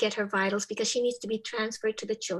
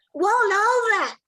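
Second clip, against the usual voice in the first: put-on high, loud, drawn-out little-girl order; child's command; 11 semitones higher, 10 dB louder, slower than usual. Little girl ordering Chipper to roll over, high and loud. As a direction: call it out like a proud little kid.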